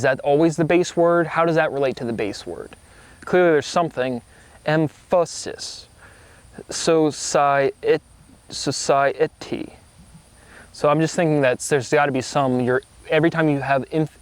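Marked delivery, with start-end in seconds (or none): 4.6-5.9 "emphasis" stretched out very slowly, each syllable separate. Shown as a demonstration. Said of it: "what syllable do you emphasize?". 6.7-9.7 "societ-, society" sounded out very slowly, syllables apart. Shown as a demonstration. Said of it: "what syllable do you emphasize?".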